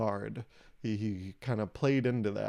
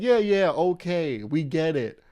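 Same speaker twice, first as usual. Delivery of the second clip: loud, higher, slow brush-off; dismissive parental scolding; 3 semitones higher, 4 dB louder than usual. Retold or imitated. Comic to microwave fake crying, loud dismissive parent scolding. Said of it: "how I was acting towards my microwave".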